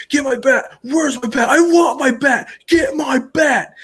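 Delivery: hammed-up threat, demanding the bat; mock furious shout. Buddy hits steering wheel, threatening.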